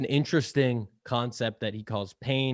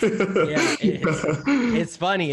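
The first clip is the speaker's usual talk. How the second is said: laughing; 7 semitones higher, 5 dB louder, much slower than usual. Real, not acted. Laughing at a joke.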